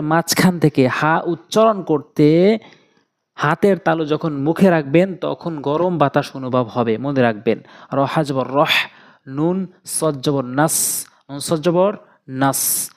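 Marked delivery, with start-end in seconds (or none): none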